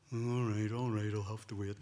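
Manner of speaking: lightly voiced resigned kid, dropping down; grudging child. Child agreeing to wait for the surprise, grudgingly resigned. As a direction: give in reluctantly, a little low.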